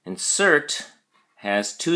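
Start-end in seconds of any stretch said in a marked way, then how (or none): 0.1-0.9 in-SERT, stress on cert, falling; stress on second syllable. Shown as a demonstration. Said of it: "the emphasis or stress is on the second syllable".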